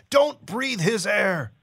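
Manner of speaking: high, slow, drawn-out warning cry; urgent shout. Acted. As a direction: stretch out a frightened warning. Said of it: "little James shouts".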